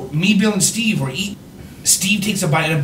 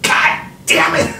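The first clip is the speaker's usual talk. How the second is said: mock angry yell; 7 semitones higher than usual. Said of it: "But [name] screams".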